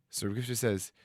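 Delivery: usual speaking voice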